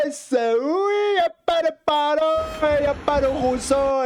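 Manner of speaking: very high, mocking put-on voice; mocking falsetto. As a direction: squeak the names out in mockery. Moquerie en voix aiguë. (voix de fausset moqueuse)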